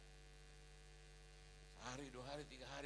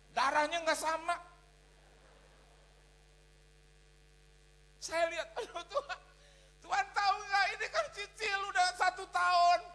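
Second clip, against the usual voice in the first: loud, exultant preaching with hallelujahs; fervent testimony; 8 semitones higher, 13 dB louder, slower than usual. Real, not acted. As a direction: shout the praise to the congregation. Testimony at full preaching force. (kesaksian berapi-api dengan seruan haleluya)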